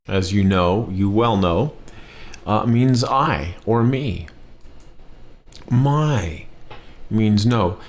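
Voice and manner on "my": My said high with a falling tone. Shown as a demonstration. Teacher showing the falling tone of the word for no. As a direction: drop the pitch sharply on My. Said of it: "falling tone on that to mean no".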